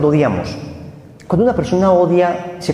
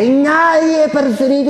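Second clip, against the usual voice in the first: hammed-up street cry; 12 semitones higher, slower than usual. The preacher mimics how the gang talked, hammed-up slang cry. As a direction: ham up the street kid's cry.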